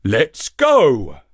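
reluctant donkey. A narrator's reading. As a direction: agree at last. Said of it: "said the donkey".

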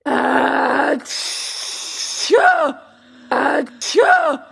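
big explosive acted "achoo"; two stagy, booming sneezes; loud sneezes. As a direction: sneeze loudly with a long build-up. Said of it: "Two loud sneezes finished his speech".

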